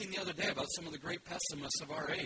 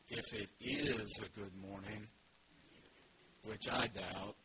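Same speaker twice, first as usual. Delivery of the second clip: low, flat, mopey donkey; gloomy Eeyore; 6 semitones lower than usual. Acted. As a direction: answer like nothing could be good. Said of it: "said Eeyore gloomily".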